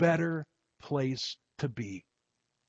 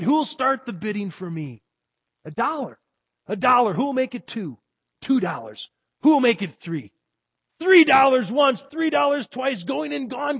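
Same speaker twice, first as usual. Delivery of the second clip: higher, brisk auction patter; auctioneer calling bids; 10 semitones higher, 7 dB louder than usual. Acted. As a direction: rattle off the bids to the crowd. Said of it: "cried he".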